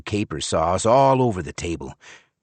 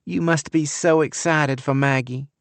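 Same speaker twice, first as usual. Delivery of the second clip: put-on sweet Southern belle accent, pitched up; syrupy Southern accent; 7 semitones higher than usual. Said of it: "syrupy accent ... Gone with the Wind".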